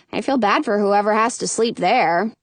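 usual speaking voice

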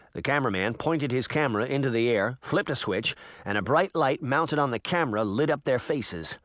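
ordinary delivery, nothing marked

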